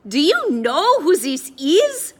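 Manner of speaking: put-on indignant, loud, high French accent; slow hammy French accent, affronted; exaggerated French accent. A narrator's reading. Addison bluffing the guard about Eddie, exaggerated French accent. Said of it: "speaking in an exaggerated French accent".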